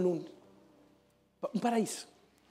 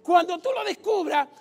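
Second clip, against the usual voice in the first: higher, louder sermon voice; fiery preaching; 9 semitones higher, 7 dB louder than usual. Acted. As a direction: preach it to the crowd. Preaching at full force.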